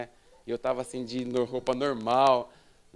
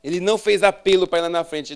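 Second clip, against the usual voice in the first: ordinary talk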